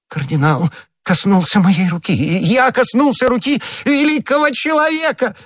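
high-pitched rapture climbing to a squeal; ecstatic outcry. A narrator's reading. Shopkeeper thrilled the cardinal touched his hand, ecstatic high cry. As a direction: cry out, overcome with adoration. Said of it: "вскричал Бонасье".

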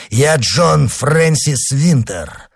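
high, slow, emphatic self-announcement; proclaiming. Acted. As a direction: spell out who you are, name by name. Avenger repeats his name.

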